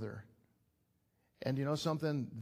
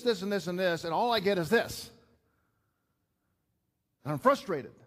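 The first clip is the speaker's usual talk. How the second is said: louder, higher, heated sermon delivery; impassioned, louder pulpit outburst; passionate preaching; 6 semitones higher, 6 dB louder than usual. Acted. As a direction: pour it out like a frustrated son. Pastor preaching with rising passion.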